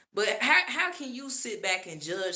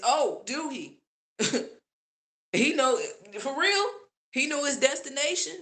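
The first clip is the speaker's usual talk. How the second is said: sarcastic disbelief; 6 semitones higher than usual. Real, not acted. Sarcastic reply to the boast.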